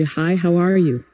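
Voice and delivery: casual American accent; friendly American. Shown as a demonstration. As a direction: say it like a cheerful barista. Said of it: "no estilo americano, sotaque suave".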